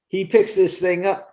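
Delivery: ordinary talk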